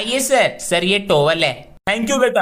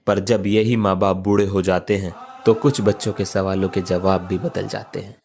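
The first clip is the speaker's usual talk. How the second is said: solemn narration; 9 semitones lower than usual. A narrator's reading.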